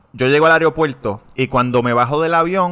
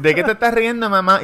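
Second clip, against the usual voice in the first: put-on high kid voice asking mom; child's voice again; 7 semitones higher than usual. Kid repeating question to mom, high voice. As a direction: pitch it up like a small boy.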